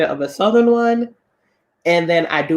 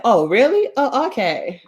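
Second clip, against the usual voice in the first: higher-pitched, acted surprise; retold mild surprise; 6 semitones higher, slower than usual. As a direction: imitate being taken aback by a confession. Retold surprise at a frank confession.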